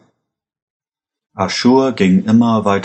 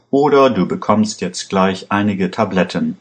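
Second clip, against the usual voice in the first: ordinary delivery, nothing marked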